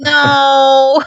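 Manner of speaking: high, drawn-out wail of dismay; pained protest. Real, not acted. Co-host begging that the bad joke stay out, pained protest. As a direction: groan a playful refusal.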